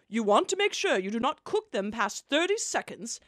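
normal talking voice

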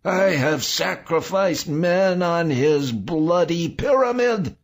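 very high, loud, hysterical tirade; raging shriek. A narrator's reading. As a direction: shriek curses at your tormentor. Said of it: "Saul shrieked".

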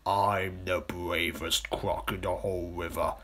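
slow, self-important crocodile voice; boastful crocodile. Acted. Big crocodile answering the accusation, slow and boastful. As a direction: answer slowly, puffed up with pride.

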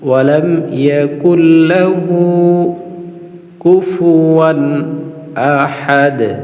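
slow melodic tajweed chant; measured Quranic chanting; Quran recitation. Shown as a demonstration. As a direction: draw each vowel out in a sung recitation. Repeats verse in recitation.

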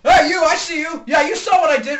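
loud, high accusing yell; mock threatening shout. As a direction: shout after a witness you just spotted. Threatening a supposed witness.

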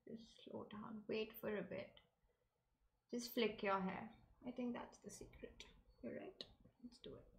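very soft, near-voiceless murmur; calming self-talk. Real, not acted. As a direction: talk yourself down under your breath. Talking herself into slowing down.

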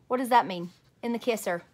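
usual speaking voice

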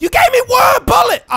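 high, loud indignant yell; loud, worked-up protest; outraged shout. Pretend. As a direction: yell in disbelief at a teammate. Outraged over one bullet given.

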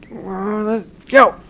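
loud, high cheer of victory; triumphant shout. Real, not acted. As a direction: yell it out in triumph. Triumphant cheer after a jump.

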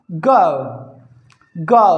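"गो" gliding from uh into oo; "गो" with an English diphthong; gliding o sound. Shown as a demonstration. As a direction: say "गो" as g-uh-oo, drawn out and falling. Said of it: "अ और उ का sound आएगा".

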